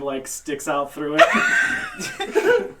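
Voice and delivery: squealing with laughter, slowed; laughing. Real, not acted. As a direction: laugh through the words. Laughing while describing the wagon.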